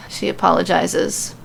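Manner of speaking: ordinary delivery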